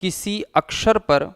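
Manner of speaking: slow, spaced dictation with long pauses; teacher dictating notes, one phrase at a time; dictation pace. Shown as a demonstration. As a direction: read it out phrase by phrase for students to write down. Dictating the definition.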